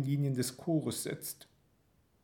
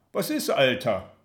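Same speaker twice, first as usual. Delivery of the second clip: louder matey call from behind; loud, friendly shout; 7 dB louder than usual. A narrator's reading. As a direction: call over the crowd, chummy. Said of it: "ruft da jemand hinter mir".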